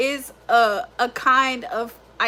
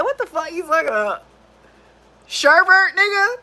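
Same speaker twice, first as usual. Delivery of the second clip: higher, louder mocking friend; mock disbelief; 5 semitones higher, 3 dB louder than usual. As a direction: throw the word back at her. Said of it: "They gonna be like".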